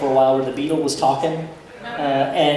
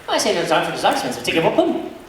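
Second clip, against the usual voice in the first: put-on garbled, run-together Scottish accent; fast Scottish talker; faster than usual. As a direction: mimic a barely intelligible Scottish fast talker. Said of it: "he talked so fast".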